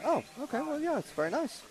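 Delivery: high, polite mock approval; retold polite reply. Pundit replaying own answer to club people, high polite voice. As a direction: pitch it way up, politely taken aback. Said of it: "I was like".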